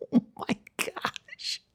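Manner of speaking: hushed, drawn-out horror; mortified whisper. Retold or imitated. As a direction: whisper it in mock dismay. Horrified parents under the dad's look.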